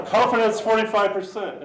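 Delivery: high, loud mock verdict; voiced software models. Models reporting back to the tester, high, loud mock announcement. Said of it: "they'd be like".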